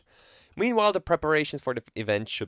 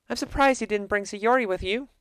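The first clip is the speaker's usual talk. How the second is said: a higher, friendly club president voice; Monika's voice; 10 semitones higher than usual. Acted. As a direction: greet him brightly, a touch higher. Plays Monika.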